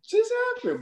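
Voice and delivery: very high squeaky wounded voice; acting betrayed by a teammate, very high; mock hurt falsetto. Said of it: "I was like".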